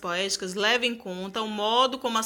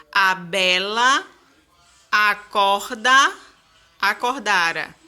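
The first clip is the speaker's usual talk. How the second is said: slow verse reading, stressed syllables pushed louder; stressed syllables marked; 5 dB louder, slower than usual. Shown as a demonstration. Teacher marking the stresses in the first stanza of Pero Meogo's song. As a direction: hit the tonic syllables of "acorda" hard and slow. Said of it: "sílabas tônicas ... marcadas em negrito".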